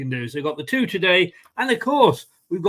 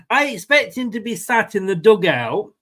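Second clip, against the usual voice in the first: ordinary talk, nothing marked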